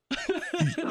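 short high-pitched laugh; embarrassed little high laugh. Real, not acted. Laughs at own admission.

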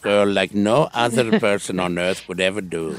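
slow, low put-on Indian accent. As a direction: lay on an Indian accent, taking it slowly. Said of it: "[name] does the Indian accent a lot".

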